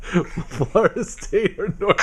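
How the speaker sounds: giggling through the words; laughing while reading the matchup. Real, not acted.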